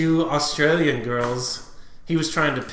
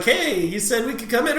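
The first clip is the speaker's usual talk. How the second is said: high-pitched voice of the girls, put on; playful imitation of girls protesting; mock honeys' voice; 9 semitones higher than usual. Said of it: "the honey's like".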